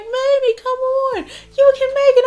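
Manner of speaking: high, loud pleading brother voice; imitating brother. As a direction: tug her along in a hammed-up voice. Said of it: "He's like".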